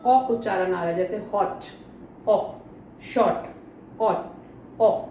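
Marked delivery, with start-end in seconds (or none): none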